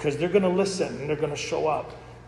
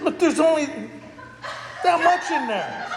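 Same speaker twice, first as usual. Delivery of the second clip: incredulous; 11 semitones higher, 3 dB louder than usual. Retold or imitated. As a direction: stretch it out, amazed. Pointing out tiny wine leftover.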